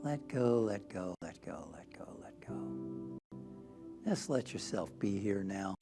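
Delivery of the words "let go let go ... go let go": chant-like repeats sinking into a bare whisper; long fade into whisper; fading away. Real, not acted. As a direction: get softer and lower with every repeat, down to breath. Repeated release cue in meditation.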